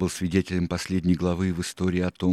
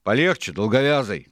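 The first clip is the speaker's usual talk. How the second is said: loud, higher sailor's retort; raised-voice protest; shouted warning; 6 semitones higher, 8 dB louder than usual. A narrator's reading. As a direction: call out sharply to back off. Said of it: "крикнул Исраэл".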